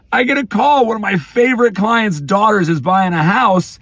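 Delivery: ordinary talk, nothing marked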